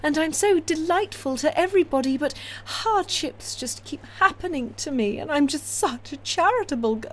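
a high cooing send-up of a Victorian ingenue; mock saintly heroine. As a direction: parody a simpering perfect heroine. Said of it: "she's a bit too".

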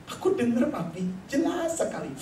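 put-on high child voice; reply to daddy like a little kid; imitating a child's high-pitched reply; child's answer. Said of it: "Kemudian dia bilang".

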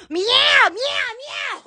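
high mock cat meows. Talking about cat ears and forty cats, playful meowing. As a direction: meow like a cat, falling on each.

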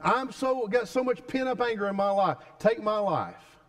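preacher acting Jonah's angry death wish; frustrated Jonah. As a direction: throw up your hands at God. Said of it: "Jonah was so frustrated, he said".

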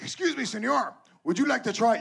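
high, sneaky dealer offering a hit; shady dealer voice. Pretend. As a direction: pitch it up like a whispering beach dealer. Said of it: "He's like".

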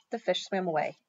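plain reading voice

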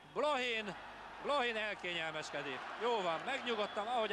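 excited commentary. Real, not acted. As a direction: burst out in relief. Relief after near miss.

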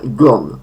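gone with a short o, falling. Shown as a demonstration. Teacher repeats the third form, short-vowelled gone. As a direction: keep gone short and let it fall. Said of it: "Jeszcze raz tą trzecią formę".

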